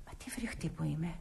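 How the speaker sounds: whispered self-reproach. Acted. Nora whispers to herself after boasting, ashamed. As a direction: breathe it out, ashamed of yourself.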